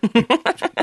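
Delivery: a short high burst of laughter. Real, not acted. Reviewer laughing at the show shot in English with a high laugh. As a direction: laugh at the absurd dubbing.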